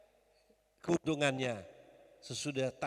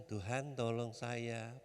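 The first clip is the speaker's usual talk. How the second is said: slow, low, softer plea for help; meek prayer; 4 semitones lower, 6 dB quieter, slower than usual. Shown as a demonstration. Preacher shows congregation how not to pray, meek and subdued. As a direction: pray it slowly and timidly, pitched down.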